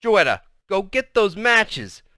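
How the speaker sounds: high, loud, slow command; forceful maternal demand; stern angry mother. A narrator's reading. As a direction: order the child, stretching each word. Mother insisting on the matches.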